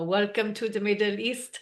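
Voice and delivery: put-on thick Israeli accent, welcoming. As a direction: deliver it like a local Israeli. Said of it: "Israelis with their very beautiful, thick Israeli accents go".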